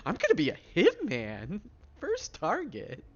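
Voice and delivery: high, loud sing-song glee; giddy excited voice. Pretend. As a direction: relish it like a gleeful kid. Repeats announcement in giddy voice.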